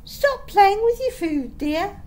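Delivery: high, loud scolding nanny; bossy nanny telling off her charge; nannyish voice. A narrator's reading. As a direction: chide a small child like a nanny. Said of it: "in a nannyish voice".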